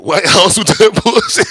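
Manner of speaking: loud and high, laughing through the punchline. Real, not acted. Laughing as he mocks the rioters. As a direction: crack up while saying it. Laughing at his own joke.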